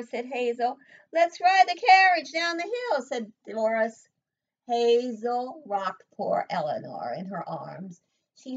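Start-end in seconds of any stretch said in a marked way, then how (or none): 1.1-3.0 higher, louder careless call; eager taunt. Acted. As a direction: brush off Hazel, keen to play. Mean girl Doris taunting.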